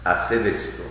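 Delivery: ordinary delivery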